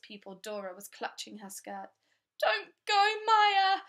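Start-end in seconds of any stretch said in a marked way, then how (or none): 2.4-3.8 put-on high, loud little girl's wail; begging her not to leave, loud high wail; wailing child. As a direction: wail it like a clinging small pupil. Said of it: "she wailed".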